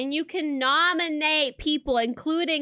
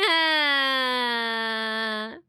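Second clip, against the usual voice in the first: one long drawn-out "haaa"; long held vocal noise. Acted. Streamer acting out reaction to confused mods, held noise. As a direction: hold a single steady wordless note.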